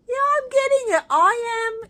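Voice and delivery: high, loud reassurance to the cat; pet talk. Real, not acted. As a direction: promise the cat loudly and high. Answering impatient cat.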